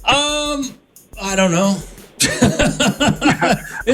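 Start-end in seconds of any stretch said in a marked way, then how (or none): 2.2-3.8 high-pitched burst of laughter. Real, not acted. Host cracks up at caller's question about how it started, laughing. Host amused, admits not knowing.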